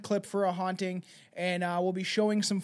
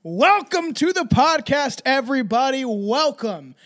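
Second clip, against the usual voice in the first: loud theatrical host greeting; podcast intro announcer; 4 semitones higher, 6 dB louder than usual. Acted. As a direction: draw it out like a showman opening the show.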